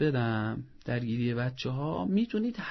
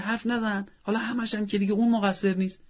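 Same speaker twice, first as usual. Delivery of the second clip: a touch of a mother's sharp rebuke, pitched higher; mock scolding mother; 7 semitones higher than usual. Mother silencing her son: lightly acted sharp rebuke. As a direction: cut him off mid-complaint.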